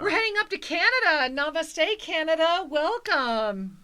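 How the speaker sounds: high, loud, rolling welcome; excited announcement. Real, not acted. Host welcomes next caller from Canada, excited announcing. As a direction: hail the whole country with gusto.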